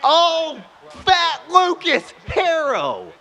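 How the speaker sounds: high, drawn-out slow cheer; exaggerated play-by-play shout; commentator cheer. Real, not acted. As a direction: stretch the name like a hyped announcer. Cheering a save in game.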